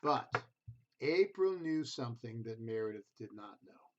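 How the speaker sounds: gentle storybook reading voice; reading to children. A narrator's reading. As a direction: read it like a bedtime picture book. Reads picture book to children.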